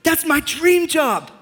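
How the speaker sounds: high, slow, hammed-up dreamy rapture; starry-eyed. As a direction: gush slowly as if utterly smitten. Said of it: "starry-eyed".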